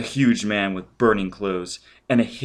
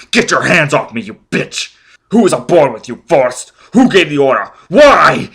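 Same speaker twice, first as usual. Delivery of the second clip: much louder, higher shouted questions; enraged yelling; 7 semitones higher, 14 dB louder than usual. Acted. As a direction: roar at both of them.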